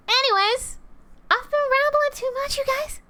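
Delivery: very high, squeaky put-on voice. Apologizing to viewers for rambling, high squeaky voice. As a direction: pitch it way up, playfully squeaky.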